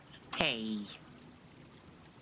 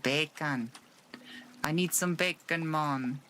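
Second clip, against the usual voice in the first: playful Jamaican accent, pitched up on need; 4 semitones higher than usual. Shown as a demonstration. Streamer riffing on a chat joke in a hammed-up Jamaican accent. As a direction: say it like a laid-back Jamaican guy. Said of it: "I'm gonna stop with that accent".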